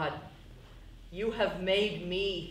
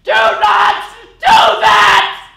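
slow, desperate scream, much higher and louder; 11 semitones higher, 19 dB louder, much slower than usual. Acted. Carol screaming at Eric over the ashes, desperate shout. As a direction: scream it out, dragging each word.

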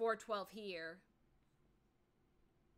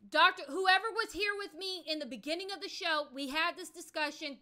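higher, louder call to chat; 7 semitones higher, 7 dB louder than usual. Real, not acted. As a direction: call out to find the viewer. Calling out to chat viewer.

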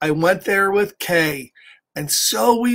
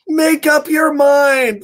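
loud, higher, drawn-out urging; emphatic call; 7 semitones higher, 5 dB louder, slower than usual. Acted. Reader urges the undecided children to choose, emphatic drawn-out call. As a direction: call it out slowly and insistently. Calling out the story's urging line.

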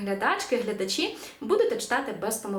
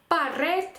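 «парет» modelled as pa-RET, pitched up; final-syllable stress; 6 semitones higher than usual. Shown as a demonstration. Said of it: "останній склад".

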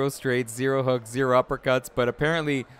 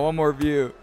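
usual speaking voice

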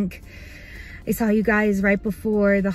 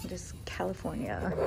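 a voiceless hushed answer; whispering; 3 semitones lower, 12 dB quieter than usual. Real, not acted.

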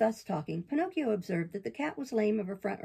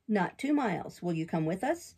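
ordinary delivery

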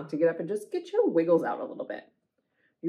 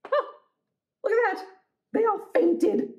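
high mock surprise; surprised monster; 12 semitones higher than usual. Monster amazed the others fainted. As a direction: gasp it out amazed.

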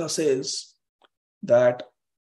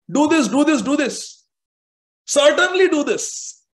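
most emphatic; 10 semitones higher, 6 dB louder than usual. Shown as a demonstration. Teacher showing the strongest emphasis of scripture, forceful. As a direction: build to a forceful peak of insistence. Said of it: "as emphatic as it gets".

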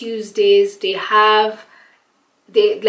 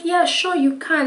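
higher-pitched, cheerful quoted yes; happy agreement; 8 semitones higher than usual. Retold or imitated. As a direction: imitate staff happily saying yes. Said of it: "they were even happy".

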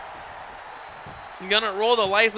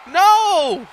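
high, loud outcry at a missed move; excited dismay; 7 semitones higher, 4 dB louder, much slower than usual. Real, not acted. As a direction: call out the miss in alarm. Reacting to a missed splash.

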